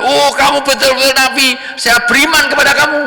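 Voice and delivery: high, loud amazed outburst; excited exclaim. Acted. The liar amazed at the revived son, high and loud. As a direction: gasp it out in awe.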